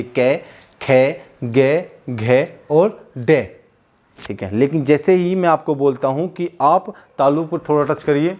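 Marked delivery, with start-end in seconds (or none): 0.0-3.6 कै, खे, गे, घे, डे drawn out slowly; slow syllable drill. Shown as a demonstration. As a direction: slowly sound out कै, खे, गे, घे, डे. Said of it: "आप किसी का उच्चारण करके देखिए". (धीरे धीरे कै, खे, गे, घे, डे)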